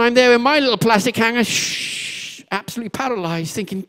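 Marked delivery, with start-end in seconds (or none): 1.4-2.4 long unvoiced "shh"; imitated shushing noise. Boy frozen with his plastic hammer, imitated shh. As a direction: let out a drawn-out hushing sound.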